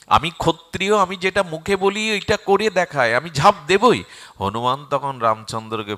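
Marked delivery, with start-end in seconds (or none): 0.1-4.0 loud, raised, proud insistence; defiant vow. Acted. Arjun vows to jump into the fire, defiant. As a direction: refuse to back down, full force.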